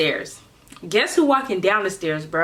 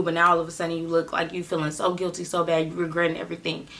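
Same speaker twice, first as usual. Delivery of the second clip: usual speaking voice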